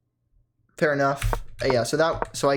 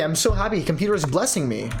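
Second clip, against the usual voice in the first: ordinary delivery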